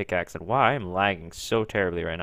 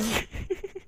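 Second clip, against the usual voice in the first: high wheezy giggling; breathless high laughter; 12 semitones higher, 9 dB quieter than usual. Real, not acted. Player laughing at his own joke, high giggles. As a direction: burst out, then trail into giggles.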